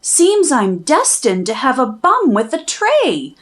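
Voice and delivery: ordinary delivery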